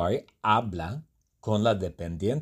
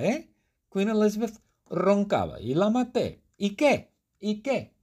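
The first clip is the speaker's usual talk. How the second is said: put-on a lightly raised, defiant young guy; Jabari character voice; 8 semitones higher than usual. Jabari explains buying the jet for Speedy, a light defiant character voice. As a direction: shrug off the killing. Jabari tells his own story.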